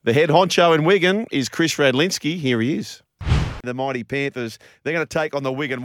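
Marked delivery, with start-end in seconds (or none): 0.1-3.0 loud, punchy radio intro; sports show announcer. Real, not acted. Presenter introducing the Wigan club boss, loud announcer read. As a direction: sell the big match at full volume.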